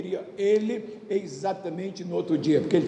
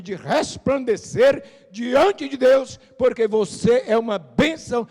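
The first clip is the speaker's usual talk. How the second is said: raised, stretched preaching voice; 5 semitones higher, 5 dB louder than usual. Acted. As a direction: preach it out to the church. Preaching to congregation.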